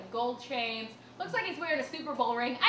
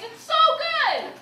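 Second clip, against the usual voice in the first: usual speaking voice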